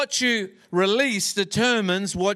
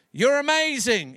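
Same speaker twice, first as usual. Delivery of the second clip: higher, called-out echo; acted distant call, pitched up; returning echo; 5 semitones higher than usual. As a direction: mimic the holler bouncing back. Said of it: "And the voice returned".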